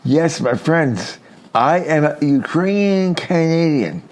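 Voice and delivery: usual speaking voice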